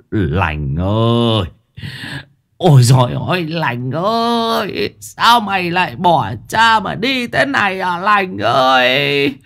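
slow drawn-out lament, high on the cries; wailing grief. Acted. Father wailing over his son's body, slow lament. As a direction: wail over the dead son. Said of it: "quỳ xuống mà khóc".

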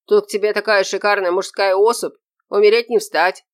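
loud, excited announcement; shouted. A narrator's reading. Orderly announces a handsome visitor to the patient, shouting. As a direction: gush it out at full voice. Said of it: "закричала она".

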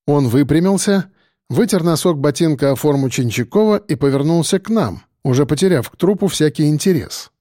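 plain reading voice

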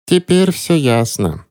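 joyless smirk. A narrator's reading. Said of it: "невесело хмыкнула гостья".

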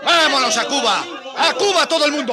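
loud, defiant call to leave; rousing shout. Acted. Colonist rouses everyone to leave for Cuba, shouting. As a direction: whip up the crowd to go.

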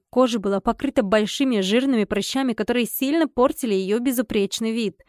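ordinary delivery

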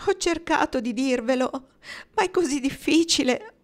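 plain reading voice

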